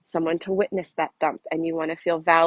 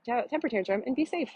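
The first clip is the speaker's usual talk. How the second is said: usual speaking voice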